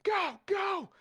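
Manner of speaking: soldiers shouting. Acted. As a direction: yell it to rally the troops. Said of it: "they're like".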